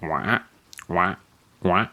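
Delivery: frog croak. Acted. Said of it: "a frog sat down beside it and croaked".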